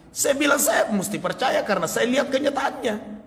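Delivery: laughing through his own retold words. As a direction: chuckle while quoting himself, pitched high. Preacher retells chat, laughing. (sambil tertawa menirukan ucapannya sendiri)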